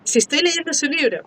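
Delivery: mock surprise. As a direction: act out a startled little realisation. Imagina descubrir al compañero escritor. (sorpresa fingida, tono más agudo)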